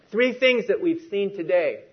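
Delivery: ordinary talk, nothing marked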